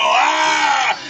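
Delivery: high, loud bear cry; scared bear's roar. Acted. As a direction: roar like a spooked grizzly. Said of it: "and the bear would go".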